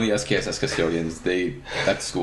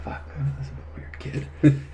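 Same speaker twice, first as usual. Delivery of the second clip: under-breath aside; 5 semitones higher, 4 dB quieter than usual. Retold or imitated. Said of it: "And I'm like".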